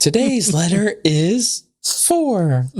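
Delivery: high, slow Sesame Street bird voice; Big Bird voice. Acted. Mocking a letter slip as Big Bird, high and slow. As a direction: drawl it out like a kids' TV puppet. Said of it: "Uh, Big Bird".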